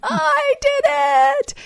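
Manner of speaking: put-on high, breathless kid squeal; excited child scream. Mother imitating daughter's triumphant squeal. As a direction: squeal it like a thrilled five-year-old. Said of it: "she screamed".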